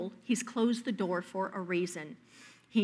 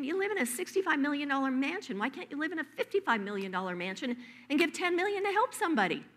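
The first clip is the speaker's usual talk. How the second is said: higher, incredulous inner rant; exasperated disbelief; 6 semitones higher, faster than usual. Retold or imitated. Preacher mocking a rich star, incredulous and high. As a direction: voice your disbelief at his greed.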